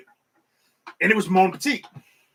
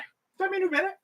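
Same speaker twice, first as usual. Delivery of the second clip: much higher, gushing; starstruck fan; 11 semitones higher, 4 dB quieter than usual. Retold or imitated. Fan pointing at a celebrity, high gushing voice.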